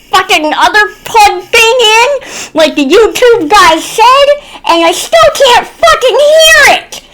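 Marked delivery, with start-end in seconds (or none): 4.7-7.0 exasperated. Retold or imitated. Retelling setup frustration.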